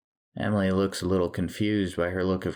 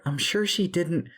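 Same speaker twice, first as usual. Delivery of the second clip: much higher, placating voice; anxious intervention; 12 semitones higher than usual. A narrator's reading. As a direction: jump in quickly to defend her. Maggie rushes between them to placate.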